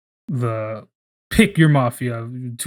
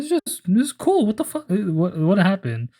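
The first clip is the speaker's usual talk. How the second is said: surprised outburst; 7 semitones higher than usual. Retold or imitated. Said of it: "He's like".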